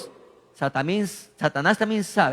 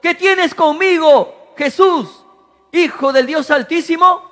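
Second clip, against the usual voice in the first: loud cry; 6 semitones higher, 4 dB louder than usual. A narrator's reading. Preacher reads the possessed man crying to Jesus, high and loud. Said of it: "exclamó a gran voz".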